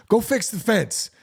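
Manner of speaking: high bossy wife order; nagging wife. Retold or imitated. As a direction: mimic the wife insisting. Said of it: "your wife has been asking you".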